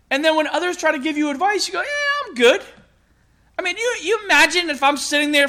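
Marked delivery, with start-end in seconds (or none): 1.8-2.6 very high, airy dismissal; imitated breezy refusal, pitched way up; mock brush-off. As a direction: wave off advice in a high-pitched voice. Retold brush-off of advice.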